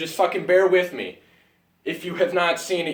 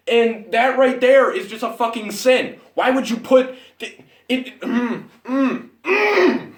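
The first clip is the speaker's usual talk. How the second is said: higher, louder sputtering outrage; outraged rant; 6 semitones higher, 3 dB louder than usual. Real, not acted. Reviewer fuming at a misplaced scene, sputtering outrage. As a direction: build into speechless fury.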